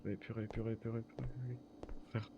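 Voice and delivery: very soft, rapid repeated curse under the breath; panicked muttering. Real, not acted. Panique chuchotée. (jurer tout bas, paniqué)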